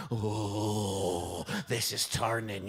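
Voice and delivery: low, sickened groan into words; queasy. Acted. Hugger after forty seconds of hugging, queasy. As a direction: sink low with growing unease. Voicing a hug going sour.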